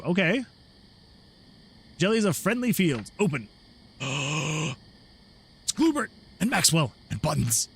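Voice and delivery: ordinary delivery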